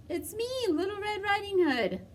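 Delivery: put-on wolf faking a little girl at the door; high girlish impersonation. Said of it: "in his best little girl voice".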